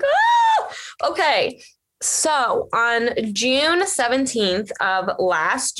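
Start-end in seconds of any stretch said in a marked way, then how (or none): 0.0-1.6 high squeal then a breathless okay; excited squeal. Retold or imitated. Answering the shelter woman, squealing with excitement. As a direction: squeal with joy at the news.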